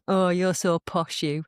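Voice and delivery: imitating relatives' regional English accent; mock teasing in a home regional accent; family's regional accent. Family member teasing about posh speech, regional accent. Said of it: "certain members of my family".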